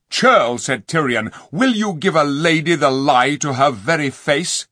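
loud, high rebuke; indignant king. A narrator's reading. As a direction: rebuke him sharply.

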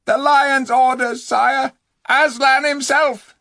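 high, loud call, slowing on Aslan; shouting. Acted. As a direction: yell it back over the water. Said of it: "shouted out".

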